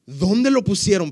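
Jesus urgently asking where Lazarus is; urgent question. Acted. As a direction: demand to know where they laid him. Jesus asks where Lazarus lies.

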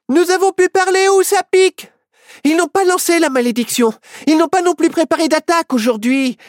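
put-on very high eager girl voice; high and breathless with news; Myrtille, excited girl. A narrator's reading. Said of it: "dit Myrtille".

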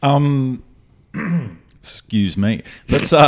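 throat catching, needs clearing; husky voice from a frog in throat. Real, not acted. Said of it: "frog in my throat".

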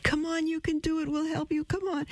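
high, coaxing voice of cheering coworkers; encouraging waitresses. Retold or imitated. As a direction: coax her on lightly, high and a bit soft. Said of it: "all the young waitresses said".

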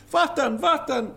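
put-on high-pitched toddler repeats; imitated small child. Host imitates the guest's son asking for water, high child voice. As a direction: pipe it up high like a little boy. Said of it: "when your son's going".

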